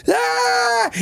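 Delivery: sudden high yelp of pain, hammed-up; mock pain scream. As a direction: cry out as if your jaw just popped. Mock pain when jaw dislocates.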